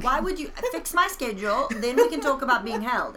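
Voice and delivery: loud, high mock exasperation; indignant protest. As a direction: argue back loudly, playing up the outrage. Acted argument with God.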